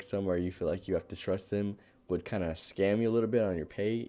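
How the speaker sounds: usual speaking voice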